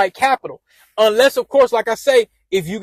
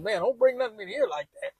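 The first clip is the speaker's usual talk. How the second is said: higher but much softer scolding mom; a hint of a stern mother; mother warning her son off, high and soft; mom's voice; 11 semitones higher, 11 dB quieter than usual. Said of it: "She said".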